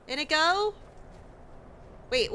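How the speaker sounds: normal talking voice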